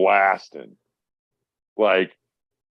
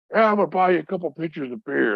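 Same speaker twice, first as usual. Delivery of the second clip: sergeant voice; 10 semitones higher, faster than usual. Retold or imitated. Sergeant offering beers.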